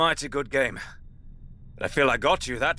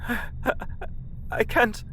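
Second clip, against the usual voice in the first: tearful stammer; 7 semitones higher, 5 dB quieter than usual. Acted. Hodor breaking down.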